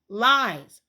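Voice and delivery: indignant retort. Real, not acted. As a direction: slam down the word Lies. Calling out the school's story.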